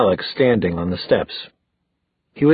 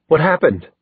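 higher, rising question; 6 semitones higher, 3 dB louder than usual. A narrator's reading. Simon asking Luke about Clary, voice rising. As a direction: let the voice climb in alarm. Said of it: "his voice rising".

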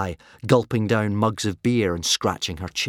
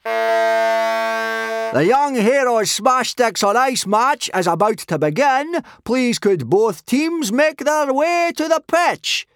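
high, loud referee's call, drawn out; shouted announcement; 5 dB louder, slower than usual. A narrator's reading. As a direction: bellow it across the ice to both teams. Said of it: "shouted Gobber the Belch".

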